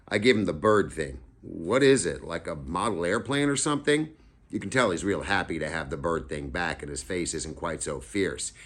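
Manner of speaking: plain reading voice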